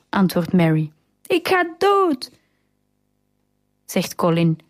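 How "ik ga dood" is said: high, drawn-out storybook wail; dramatic wail. A narrator's reading. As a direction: stretch it out high, like a distressed child. Boy's outburst in quarrel. (hoge, langgerekte jammerklacht)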